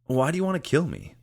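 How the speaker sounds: high, firm, straight ask; Michael boldly questioning the robot; confident question. Acted. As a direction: ask it without fear. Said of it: "felt confident in the question".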